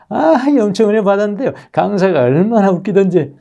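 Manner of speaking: high, chirpy gushing churchgoer; giddy churchgoer. Retold or imitated. A churchgoer gushing that the speaker was so funny, high and giddy. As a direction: squeal like a delighted churchgoer. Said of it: "이래버립니다".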